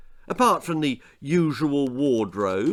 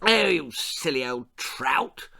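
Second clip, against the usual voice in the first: irritable lord snapping, voice swooping up; peevish, theatrical scolding; snappish; 3 semitones higher than usual. A narrator's reading. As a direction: snap it crossly at a spouse. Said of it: "he snapped at his wife".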